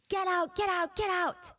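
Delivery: high-pitched mock alarm cry; urgent yelling. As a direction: imitate a chef frantically ordering everyone out. Chef runs out yelling warning.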